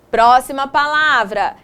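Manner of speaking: ordinary delivery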